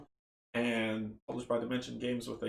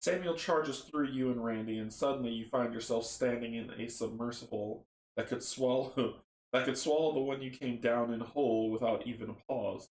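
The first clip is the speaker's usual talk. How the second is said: ordinary talk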